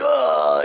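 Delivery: high yelp of pain; comic high-pitched ouch. Acted. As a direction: squeal it out in mock pain. Superman hurt, acted cry.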